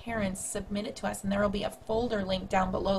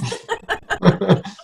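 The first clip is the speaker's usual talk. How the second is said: witch cackle; 11 dB louder than usual. Shown as a demonstration. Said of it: "bring out my witchy voice".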